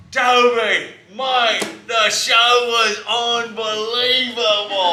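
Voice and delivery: shouted mock Aussie accent, very high and loud; hammed-up Australian yell, last words stretched out; bad Australian accent. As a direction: bellow it like an overexcited Australian fan. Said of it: "once again, I'll do a bad accent".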